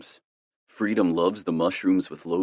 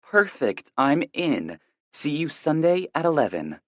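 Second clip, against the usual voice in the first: lightly voiced woman, higher; female voice; 10 semitones higher, 3 dB louder than usual. A narrator's reading. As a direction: read it as the woman friend. Friend's text read in her voice.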